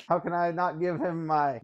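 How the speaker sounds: usual speaking voice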